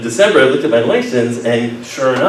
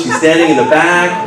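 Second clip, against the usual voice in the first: normal talking voice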